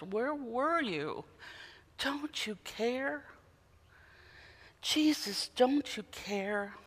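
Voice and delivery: soft, slow pleading to Jesus; hushed pleading cry. Acted. Grieving loved ones quietly pleading with Jesus, softly slow. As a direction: ask it quietly and slowly, aching. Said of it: "to cry out like the disciples".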